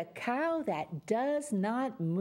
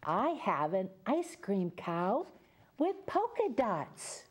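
put-on reading to children; 2 semitones lower than usual. Reads picture book to children.

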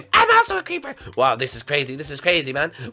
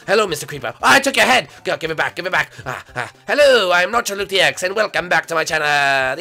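ordinary delivery

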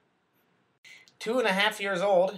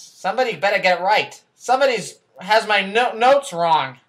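angry outburst; 3 semitones higher, 10 dB louder than usual. Acted. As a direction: yell at whoever messed up the notes. Anchor blows up at staff.

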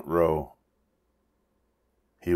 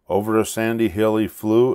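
ordinary delivery

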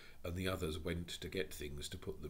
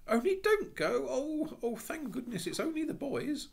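put-on high, bright child's voice; a high little-girl voice; 9 dB louder than usual. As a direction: call out like an eager little girl. Jane pleads.

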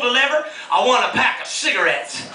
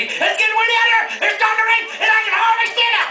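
high, breathless reporter voice in the wind; frantic storm reporter; 11 semitones higher, faster than usual. Retold or imitated. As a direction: yell it over an imagined hurricane. TV weather reporter parody.

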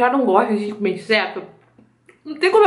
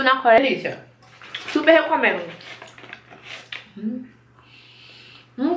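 sausage stick at lips; talking while chewing. Real, not acted. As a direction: mouth partly full. Seen 1: Chews early on; stick reaches her lips only at 3.5-3.7s.

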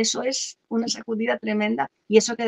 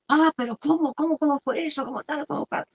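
stunned father; 6 semitones higher than usual. Retold or imitated. Father hearing the news, high and astonished. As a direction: sputter like a dad caught off guard. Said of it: "se lo dice a papá".

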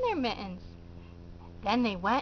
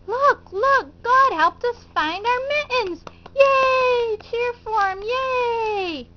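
high, loud, thrilled little kittens; excited kittens; 9 semitones higher, 4 dB louder than usual. Acted. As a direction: burst out with joy to Mother. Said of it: "showed Mother their kittens".

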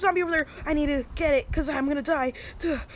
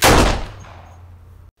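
sharp gasp; 10 dB louder than usual. Real, not acted. Spots a zombie suddenly.

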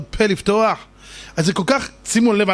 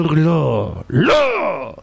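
defiant no; much slower than usual. Retold or imitated. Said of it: "ופתאום אתה אומר".

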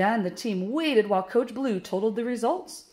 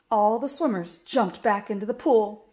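usual speaking voice

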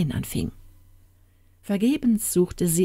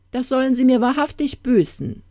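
higher, louder royal outrage; wrathful storybook king; indignant threat; 7 semitones higher, 5 dB louder than usual. Acted. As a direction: vow punishment as an affronted king. King vows punishment for desertion.

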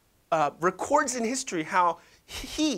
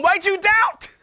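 high, loud scolding jab; mocking Jesus voice; 14 semitones higher, 10 dB louder, slower than usual. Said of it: "then you tell them".

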